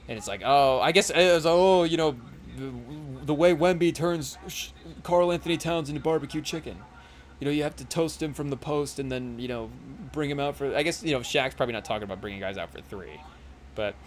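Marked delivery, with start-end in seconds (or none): 0.4-10.7 Shaq impression. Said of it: "doing the Shaq impersonation".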